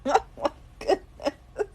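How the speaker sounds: breathless, voiceless laugh through the words; can barely get it out for laughing; laughing helplessly. Real, not acted. Reacting to a chat joke, breathless laughter.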